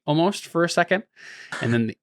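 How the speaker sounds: ordinary talk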